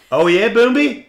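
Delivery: loud, high call to a pet. Real, not acted. Spotting the dog mid-chat, calling out loud and high.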